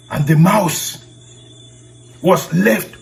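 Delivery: ordinary delivery, nothing marked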